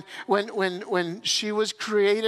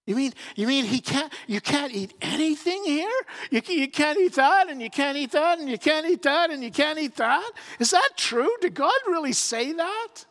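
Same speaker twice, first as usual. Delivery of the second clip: mocking disbelief; 7 semitones higher than usual. Snake feigning shock at Eve, high mocking disbelief. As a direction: needle her with fake shock, voice up high.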